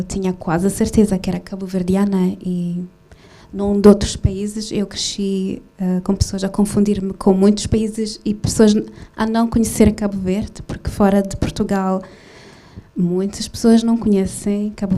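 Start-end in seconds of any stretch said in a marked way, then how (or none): none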